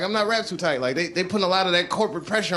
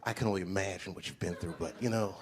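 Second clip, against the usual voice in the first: soft, lower condescending comfort; mock advice giver; 10 semitones lower, 13 dB quieter than usual. Show-business friend consoling Dave, lightly mocked soothing. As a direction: patronise him gently. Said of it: "they be saying stupid".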